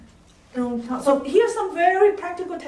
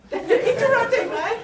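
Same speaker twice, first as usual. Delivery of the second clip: laughing; 9 semitones higher, 4 dB louder than usual. Real, not acted. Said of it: "laughs at her own demo".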